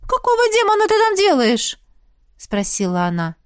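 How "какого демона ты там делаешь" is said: high-pitched incredulous demand; what on earth, pitched way up; astonished. A narrator's reading. Friend demanding what she is doing there, astonished.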